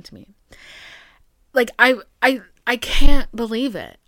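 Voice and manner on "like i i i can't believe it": much louder, fed-up cry; venting that she can't believe it; exasperated outburst. Real, not acted. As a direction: blurt it out loud in frustration. Outburst at people.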